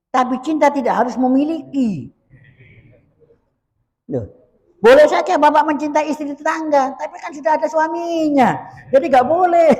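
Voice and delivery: forceful preaching. Acted. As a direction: preach it hard and high. (suara ceramah yang berapi-api dan lantang)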